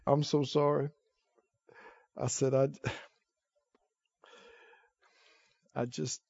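soft, choked retelling of a tearful apology; hushed and near tears, begging forgiveness. Retold or imitated. Preacher retelling his apology to Brother Hagin, hushed and teary.

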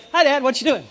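put-on cheery child greeting. Child bounding up to dad, cheery and high. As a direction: chirp it like a kid with no care.

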